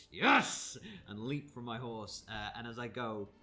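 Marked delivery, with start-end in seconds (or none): 0.1-0.8 loud, high cry of triumph; excited shout. Acted. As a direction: yell it out in glee. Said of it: "I'm gonna be like".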